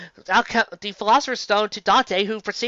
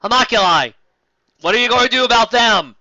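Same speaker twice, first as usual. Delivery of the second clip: louder, pressing demand; emphatic exclamation; 4 semitones higher, 7 dB louder than usual. Pretend. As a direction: hammer the question home. Exclaims the question again.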